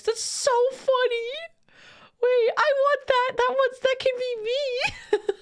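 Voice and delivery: giddy with excitement; excited squeal. Real, not acted. Excited about feral orc humans.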